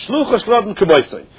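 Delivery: ordinary talk, nothing marked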